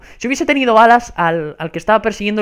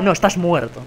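normal talking voice

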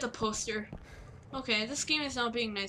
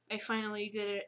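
normal talking voice

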